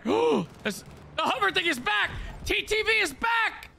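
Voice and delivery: sudden high urgent alarm; alarmed callout. Real, not acted. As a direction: yell the warning fast. TTV enemy suddenly returns.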